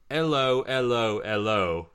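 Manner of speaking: loud, high-starting Cockney greeting; bad Michael Caine-style Cockney; Cockney hellos. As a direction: call out three hellos in a Cockney accent, stepping down in pitch. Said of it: "he sounds like he's doing a Michael Caine impression".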